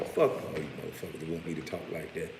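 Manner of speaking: usual speaking voice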